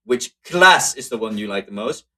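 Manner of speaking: "class" punched loud; stress on class. Shown as a demonstration. Teacher showing which word ga emphasizes, stressed "class". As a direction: hit "class" hard near the start. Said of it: "putting the emphasis once again on the class".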